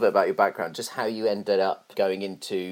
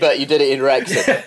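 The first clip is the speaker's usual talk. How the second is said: laughing; 2 semitones higher, 6 dB louder than usual. Real, not acted. Teasing punchline to guest.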